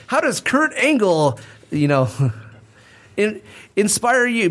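high, slow mock interviewer voice. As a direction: ham up a cheesy interviewer. Mock interviewer question, quoted.

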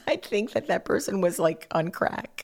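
a hint of a scoffing teenager; mock teen self. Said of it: "And I'd be like".

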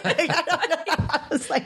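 high voice breaking up with real laughter; laughing. Real, not acted. Patient joking she needs a drink instead, really laughing. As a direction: talk through a real laugh.

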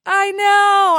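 high, loud gleeful I know; delighted squeal. Real, not acted. Playful delighted agreement.

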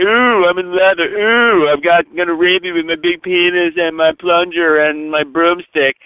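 campy, mock-menacing ooh; taunting mock villain. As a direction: ham up the leather villain in a high put-on voice. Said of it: "you thought that crap was scary".

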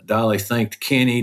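normal talking voice

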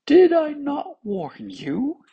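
very high, slow chiding voice; unsorrowful tongue. A narrator's reading. Tongue reproaches the other parts, gloating. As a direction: crow it in a high character voice. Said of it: "was not sorrowful at all".

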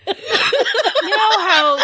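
giggling while trying to explain; laughing. Real, not acted. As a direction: laugh through the words. Laughing search for words.

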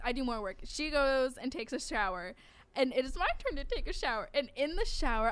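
usual speaking voice

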